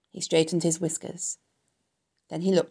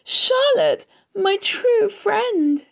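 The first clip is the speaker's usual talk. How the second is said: high, loud, drawn-out wail; anguished moan; 6 dB louder, much slower than usual. A narrator's reading. Wilbur mourns his true friend, anguished moan. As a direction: stretch it out in sobbing grief. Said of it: "he moaned".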